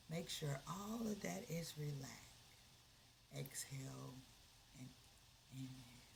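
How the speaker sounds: a calm coach leading the breath; guided relaxation. Acted. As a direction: talk them down into calm. Leads a relaxation exercise.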